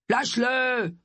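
high, forceful command; fierce, drawn-up command; growled order. A narrator's reading. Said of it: "grogna-t-il".

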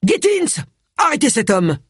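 high-pitched urgent call; hailing an officer. A narrator's reading. Inspector summoning an officer to arrest, calling out. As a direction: call out the name, then order. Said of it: "Wilkes héla l'un de ses agents".

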